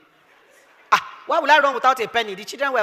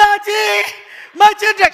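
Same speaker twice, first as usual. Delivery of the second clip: pleading cry; 9 semitones higher, 6 dB louder, much slower than usual. Retold or imitated. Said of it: "I said".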